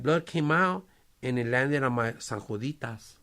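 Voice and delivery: ordinary delivery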